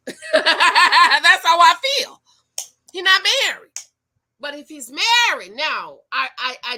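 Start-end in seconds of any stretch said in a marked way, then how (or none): 0.1-2.2 laughing through the words; amused laugh over the line. Real, not acted. Laughing while stating her view. 2.9-3.7 emphatic. Real, not acted. Emphatic punchline after laugh.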